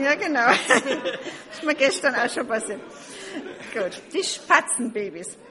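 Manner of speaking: loud embarrassed laughter; laughing at a slip. Real, not acted. Reader laughs at mixing up kittens and sparrows, loudly. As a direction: laugh off your own mistake.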